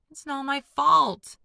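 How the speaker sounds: high, loud, slow protest. A narrator's reading. Younger boy protests against his brothers, loud and high. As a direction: cry out in his own defence. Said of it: "Luke protested".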